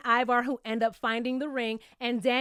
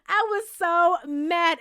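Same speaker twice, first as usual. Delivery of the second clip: laughing slowly through it; slow, amused, laughing through the words; 4 semitones higher, 4 dB louder, slower than usual. Real, not acted. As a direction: drag it out with a laugh.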